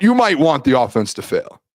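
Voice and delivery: normal talking voice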